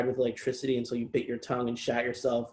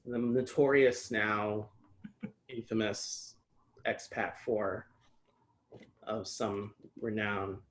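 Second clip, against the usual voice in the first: mock dramatic; 6 dB quieter, slower than usual. Real, not acted. Jokingly hypes own piece.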